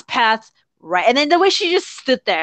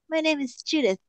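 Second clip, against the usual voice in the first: soft, higher mock-cool introduction; Judith; 5 semitones higher, 7 dB quieter than usual. Fan mocking the hat pull, hushed mock-cool introduction. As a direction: murmur it self-importantly, sending up the character. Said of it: "the way she pulls the hat".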